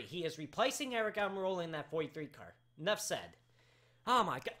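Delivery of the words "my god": ordinary talk, nothing marked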